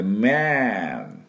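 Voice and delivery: man with lips closed on the M, in English; teacher modelling man with a humming M. Shown as a demonstration. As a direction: hum the M, then man. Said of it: "おみゃーの音で".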